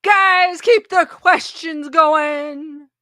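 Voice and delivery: loud, long-stretched holler; rally the viewers with a drawn-out call; big playful announcer-like shout. Real, not acted. Calls out to live viewers.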